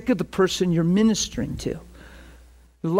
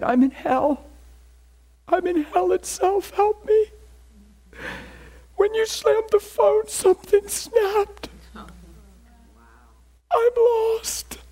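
much higher, strained voice of someone falling apart; imitating desperate crying; slower than usual. Preacher voicing the man who phoned back, high and despairing. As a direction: play a broken man begging for help, pitched way up. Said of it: "the person crying uncontrollably".

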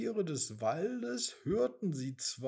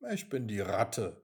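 lower, unimpressed rat; cool rat; 6 semitones lower, 3 dB louder than usual. Acted. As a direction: refuse to bow, calm and sly. The rat answers coolly. (tiefe, gelassene Ratte)

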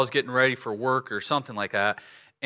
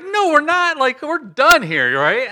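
high, loud, slow refusal; loud protest; 12 semitones higher, 8 dB louder than usual. Retold or imitated. To the eye doctor, refusing another puff, loud high protest. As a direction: refuse it outright, pleading. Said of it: "I'm like".

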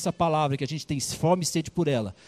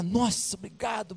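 higher, breathy grateful servant; relieved thanks; 4 semitones higher, 6 dB quieter than usual. Acted. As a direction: gush thanks at the king. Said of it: "Aí o cara".

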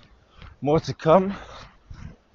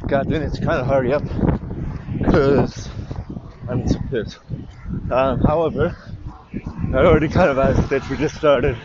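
ordinary talk

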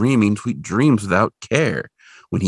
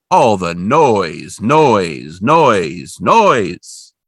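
slow, loud, drawn-out noise; repeating the noise with mounting annoyance; exasperated; 4 dB louder, much slower than usual. A narrator's reading. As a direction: lean on each noise with disgust. Repetition dramatized.

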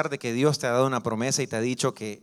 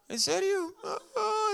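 put-on much higher, hurt child's voice; little boy voice; slower than usual. Preacher as a small boy hearing he's adopted, high and hurt. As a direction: squeak it like a crushed little boy.

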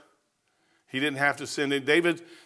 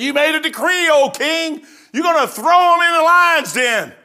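high, loud, insistent accuser rising to a shout; accusing shout; 11 semitones higher, 7 dB louder than usual. Acted. Accuser pressing the king about Daniel, shouting. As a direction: push the decree on the king, building to a yell.

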